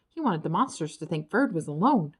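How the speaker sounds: ordinary delivery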